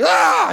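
loud, high "ah" yell; mock yell. Husband faking a wild throw, mock yell. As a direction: let out a hammed-up holler.

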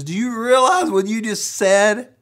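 put-on teacher scolding a boy, shrill and loud. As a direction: tear into the boy like a shocked Sunday school teacher. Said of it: "she came against me".

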